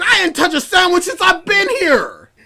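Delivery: a hint of outrage at being blamed; indignant protest. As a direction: protest your innocence, outraged. Indignant reply to accusation.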